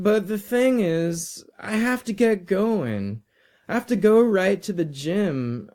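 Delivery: high-pitched, eager-to-leave student; apologetic polite boy. Acted. Boy politely declines and thanks.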